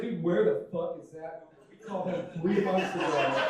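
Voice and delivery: loud, exasperated complaint as one of three waiting buddies; impatient turd. Acted. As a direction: play an annoyed guy kept waiting. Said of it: "imagining it as three turds".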